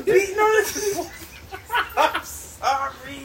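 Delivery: high, slow words through laughter; can't get it out for laughing; laughing helplessly. Real, not acted. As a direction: crack up while trying to apologize. Breaks up laughing mid-apology.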